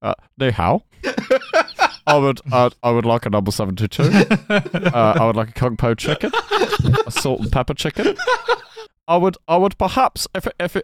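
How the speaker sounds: a hint of a stiff, formal politician ordering takeaway, laughing through it; half-laughing pompous order of kung po chicken; laughing mock Keir Starmer. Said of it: "perfect Keir Starmer impression".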